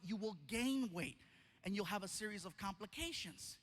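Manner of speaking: ordinary talk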